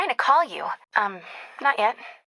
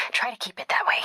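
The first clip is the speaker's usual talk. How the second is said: whisper. Acted. Partner whispering advice while sneaking past guards. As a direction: whisper it, stay hidden. Whispering during stealth mission.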